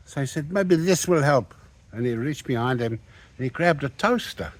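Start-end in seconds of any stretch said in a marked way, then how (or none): 0.5-1.5 a higher, louder mock threat; menacing. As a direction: taunt him before throwing. Owner about to throw a toaster.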